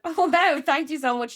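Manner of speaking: loud, high retold burst of delight; gushing thanks. Thanking a passing stranger for praising the laugh, gushing. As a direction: re-enact being thrilled by a compliment.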